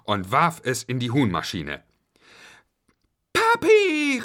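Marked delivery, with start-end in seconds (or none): 3.3-4.2 high, loud joyful cry; raised, excited child's shout; children calling out. A narrator's reading. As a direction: call out to daddy as kids. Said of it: "riefen alle".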